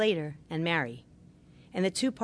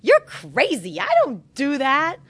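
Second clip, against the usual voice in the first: loud, high, scoffing retort; dismissive denial; 7 semitones higher, 8 dB louder, slower than usual. Retold or imitated. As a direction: brush it off loudly, defensive.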